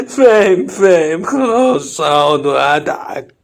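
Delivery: loud, hammed-up crying while agreeing to laugh; tearful. Son forced by father to sit and laugh, mock crying. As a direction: give in with a loud mock sob. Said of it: "ما أنت بتعيط أهو".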